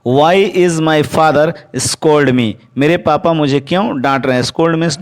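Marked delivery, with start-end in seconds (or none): 0.1-2.5 very slow, word by word, clearly spaced; slow dictation. Shown as a demonstration. Teacher dictating to the class, very slow and clear. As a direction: spell it out slowly for students to write. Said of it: "teacher dictates the English sentence".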